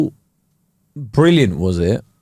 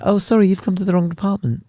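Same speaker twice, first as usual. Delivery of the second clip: high put-on customer service voice; mock a clueless agent, pitched up; unhelpful agent; 7 semitones higher, faster than usual. Agent turns the customer away, high mock apology. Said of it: "they're like".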